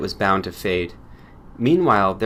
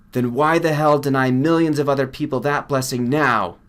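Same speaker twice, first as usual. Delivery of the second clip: a lightly voiced colleague, pushing, a bit louder; Jack pressing hard; 4 semitones higher, 5 dB louder than usual. Colleague demands why cures are withheld, mildly indignant. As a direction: lean on him with indignant impatience.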